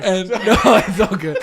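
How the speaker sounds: trying to talk through real giggles; barely holding the laugh in; composing yourself mid-laugh; fighting back laughter. Real, not acted. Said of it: "hard for me to get it out".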